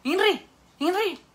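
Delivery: a hint of a curious parrot calling out; the punished parrot calling up to the cross. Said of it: "y dice".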